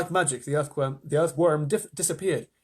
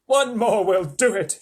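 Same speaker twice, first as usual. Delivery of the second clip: high, loud, slow shout; 6 semitones higher, 7 dB louder, slower than usual. A narrator's reading. Old grasshopper calling from tunnel, urging shout. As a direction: call out encouragement at full voice. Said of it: "shouted the old green grasshopper".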